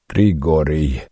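plain reading voice

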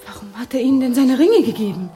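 high, loud, incredulous question; agitated challenge. Acted. Marge confronting Tom over the rings, agitated.

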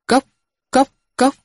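high clipped tok tok tok of a wooden bell; wooden knock imitation. Crier's wooden bell beating, high vocal knocks.

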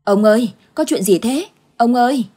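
wife calling out in alarm; urgent calling. Acted. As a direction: shout for him while running. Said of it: "vừa chạy vừa hỏi dồn".

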